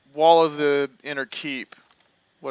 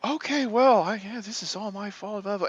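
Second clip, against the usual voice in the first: mock doormat server; 6 semitones higher than usual. Mocking doormat customer-service voice.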